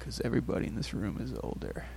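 low unhinged whisper, half-joking; crazy voice. Pretend. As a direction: hiss it like a lunatic. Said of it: "That was my crazy voice".

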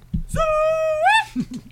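long, very high whoop. Shown as a demonstration. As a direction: let out a drawn-out country whoop. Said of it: "Here's a hint".

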